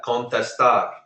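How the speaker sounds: contestar stressed on the last syllable, con-tes-TAR; end stress. Shown as a demonstration. Teacher giving contestar for practice, stress on final TAR. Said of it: "Practice that".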